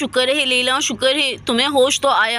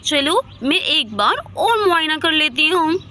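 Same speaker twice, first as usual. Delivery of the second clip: ordinary delivery, nothing marked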